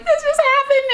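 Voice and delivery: high, teary, choked-up retelling; teary quote. Retold or imitated. On the phone to her best friend, high teary squeak. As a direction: squeak it out like you're still crying. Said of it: "And I was like".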